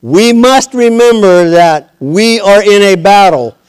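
high, loud pulpit exhortation; full-force preaching. Real, not acted.

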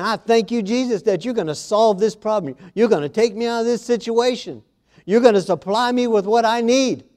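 a believer praying with bright faith; grateful praise. Shown as a demonstration. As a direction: thank Jesus with confidence. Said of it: "That's what you need to say".

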